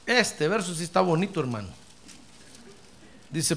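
normal talking voice

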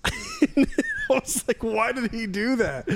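high laughing disbelief. Real, not acted. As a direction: laugh through the question. Laughing retelling.

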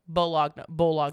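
Bologna said boh-LOG-nuh, loud, with the G sounded; spelling pronunciation. Shown as a demonstration. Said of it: "how to spell baloney".

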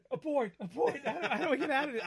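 loud, high, laughing panic; panicked abort. Retold or imitated. Parent panicking over the chicken question, laughing loudly. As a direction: yell abort like a flustered parent. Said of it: "I'm like".

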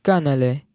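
"Cannelé" landed the French way; French pronunciation. Shown as a demonstration. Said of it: "I think I got it".

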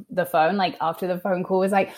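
ordinary talk, nothing marked

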